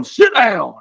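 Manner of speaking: commanding order. Retold or imitated. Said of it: "You tell 'em".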